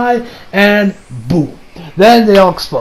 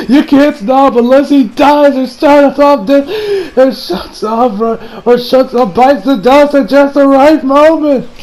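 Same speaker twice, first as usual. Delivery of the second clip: high, drawn-out mock anguish; desperate cry; 6 semitones higher, slower than usual. Retelling why it can't be stopped, acted desperate cry. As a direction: wail each phrase slowly, pitched up. Acting a character's desperate explanation.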